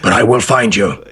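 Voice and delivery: mock-menacing action-star voice; impersonated tough avenger; Liam Neeson. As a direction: deliver a film threat in a put-on voice. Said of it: "do a Liam Neeson impression".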